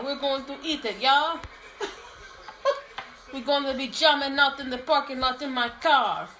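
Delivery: hammed-up tropical Jamaican cousin; Jamaican accent. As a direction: put on a lilting Jamaican accent. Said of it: "very tropical cousin from, like, Jamaica".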